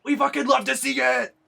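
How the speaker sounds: loud, high delighted shout; excited cheer. Real, not acted. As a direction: cheer it out at the top of your voice. Cheering at a game romance.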